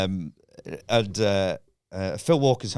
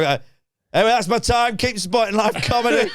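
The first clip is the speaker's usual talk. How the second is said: high, loud forced cheer; mock-chipper showman's farewell; hasty stage sign-off; 11 semitones higher, 4 dB louder than usual. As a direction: call out your goodbye over the boos. Acts hasty sign-off while booed.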